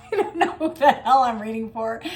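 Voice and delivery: real amused laugh. Real, not acted. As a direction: laugh it out, baffled. Laughs at her reading.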